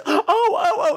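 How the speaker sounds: very high, thrilled little oh oh oh; excited squeal. Retold or imitated. Mother spots a stranger in a Quacker sweater, squealing very high. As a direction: squeal with delight at spotting someone. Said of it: "She goes".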